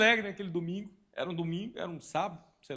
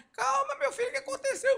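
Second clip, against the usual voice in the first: put-on kind little old lady. Friendly old ladies soothing a lost boy, high sweet old lady voice. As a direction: comfort the boy like a gentle granny. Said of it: "duas senhorinhas bem simpáticas".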